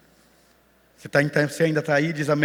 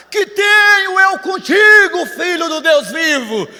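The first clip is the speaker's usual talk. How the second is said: demoniac's scream; 13 semitones higher, 10 dB louder than usual. Acted. Possessed man at Jesus' feet in Gadara, screaming. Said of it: "começa a gritar".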